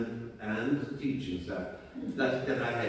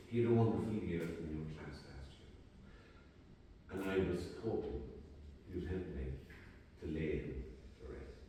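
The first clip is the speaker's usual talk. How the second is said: tearful father; 5 semitones lower, 9 dB quieter than usual. Retold or imitated. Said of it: "tear-driven face".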